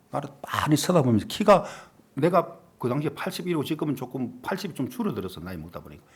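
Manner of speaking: normal talking voice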